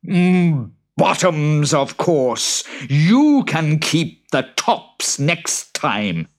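high, slow creature voice; smug high boggart; sly boggart. Acted. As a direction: pick it craftily. Boggart choosing.

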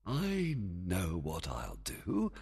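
slow sly murmur. Acted. Oberon's sly idea.

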